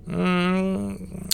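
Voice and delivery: put-on high, drunken lowing hum; drunken mumble. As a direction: moan it out tipsy. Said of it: "промычал я".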